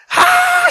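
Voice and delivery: high mock scream of panic; retold scream. Wife shrieking at tick on daughter's eye, mock scream. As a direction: shriek like a startled mother. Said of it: "She's screaming".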